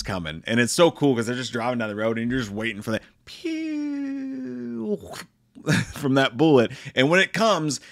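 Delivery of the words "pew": high, slow mock gunshot pew; drawn-out high pew sound effect. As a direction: imitate a rifle shot with the mouth. Imitating the gunshot.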